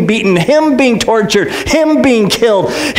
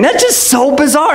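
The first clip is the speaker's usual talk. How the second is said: ordinary talk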